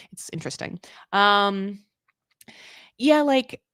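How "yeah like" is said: ordinary delivery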